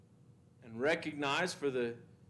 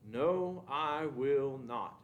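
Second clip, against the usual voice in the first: "I will not" slowed right down, each word weighted; emphatic refusal; much slower than usual. Retold or imitated. Teacher reading Paul's rebuke, slow emphatic "will not". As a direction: lean hard on "will not", very slowly. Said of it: "that last phrase is very emphatic".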